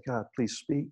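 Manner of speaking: soft, hushed plea; quiet prayer. Acted. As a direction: ask God softly.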